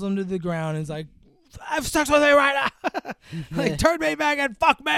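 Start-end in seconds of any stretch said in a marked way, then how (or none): 1.6-3.1 mock demanding doctor. Doctor voiced in retold scene. 3.8-4.9 mock demanding doctor. Doctor voiced in retold scene.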